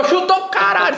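excited shout. Retold or imitated. Narrating a goal moment.

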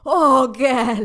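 imitated Jamaican moan; put-on Jamaican lover crying out; mock-Jamaican cry of pleasure; Jamaican accent. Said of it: "like, a full-on Jamaican accent".

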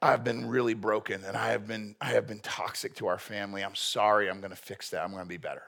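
soft, fading, contrite admission; a gentle hint of a sorry relative owning up; humble apology. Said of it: "their response is".

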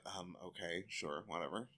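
mock reluctant mutter; reluctant mumble. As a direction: shrug it off grudgingly. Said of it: "they're like".

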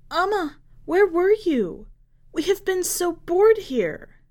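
put-on a bored little kid calling to Mum; child voice. Child runs to mother asking where she was.

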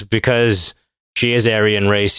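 ordinary delivery

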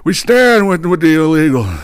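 high, put-on sanctimonious voice; high mock-pious voice sneering at opponents; mocking Democrats. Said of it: "they sit there and say".

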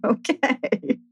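high, startled "really" breaking into a laugh; laughing in disbelief at being forgiven; surprised relief. Retold or imitated. To a friend over drinks, surprised laughing relief. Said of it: "And she's like".

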